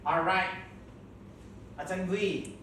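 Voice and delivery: usual speaking voice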